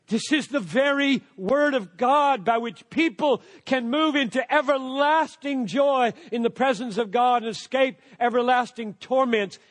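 ordinary talk

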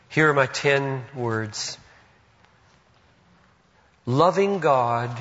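ordinary talk

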